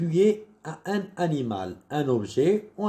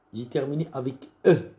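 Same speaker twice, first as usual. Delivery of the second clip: normal talking voice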